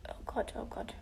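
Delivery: breathy whispered oh God; soft panicked whisper. Real, not acted. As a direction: hush it under your breath. Whispering to herself.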